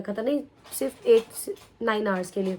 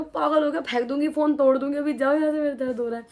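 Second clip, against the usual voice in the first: acted-out tirade, raised, easing off at the end; retold angry yelling; 4 semitones higher, 4 dB louder than usual. Patient in pain sending mother away, retold angry yell. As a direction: re-enact ordering someone away through pain. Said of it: "मैं बहुत तेज तेज चिल्ला रही थी".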